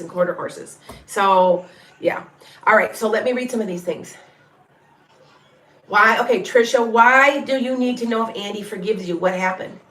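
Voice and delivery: ordinary talk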